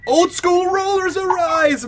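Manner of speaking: high, loud, stretched-out announcer call; mock grand proclamation. Player breaks off the argument for a grand mock announcement. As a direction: belt it out slowly like a show opener.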